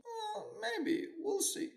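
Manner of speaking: put-on very high, soft, slow old-man voice; old man shrugging off good luck, high and slow; serene old man. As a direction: drift it out high and slow, unbothered.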